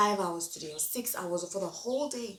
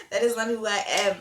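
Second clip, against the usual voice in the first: louder, forceful declaration; emphatic repeat; 2 semitones higher, 8 dB louder than usual. Real, not acted. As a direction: say it louder, with full conviction.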